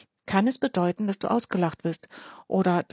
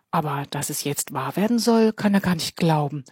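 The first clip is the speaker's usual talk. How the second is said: ordinary delivery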